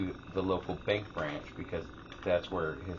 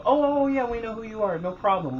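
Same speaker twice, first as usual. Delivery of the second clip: high, loud burst of recognition; eager recognition; 14 semitones higher, 7 dB louder than usual. Retold or imitated. Bank staff greet the expected mother, in eager recognition. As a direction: imitate staff suddenly remembering, pitched way up.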